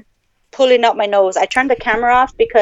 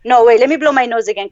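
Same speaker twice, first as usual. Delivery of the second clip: ordinary talk, nothing marked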